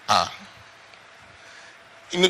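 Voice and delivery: usual speaking voice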